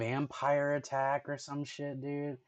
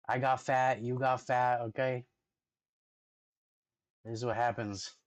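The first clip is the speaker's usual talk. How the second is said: normal talking voice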